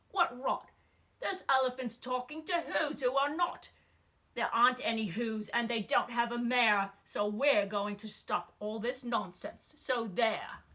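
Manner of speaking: loud mocking monkeys; jeering shout. Monkey brothers jeering at Horton, loud scornful shouting. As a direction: yell them down, scornful and rowdy. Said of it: "The Wickersham brothers came shouting".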